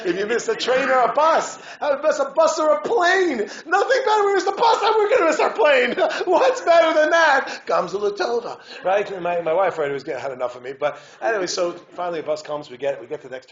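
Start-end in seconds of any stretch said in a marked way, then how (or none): 0.1-7.6 high, loud, laughing glee; overjoyed. Retold or imitated. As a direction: whoop it out, giddy with joy. Father voicing his overjoyed self.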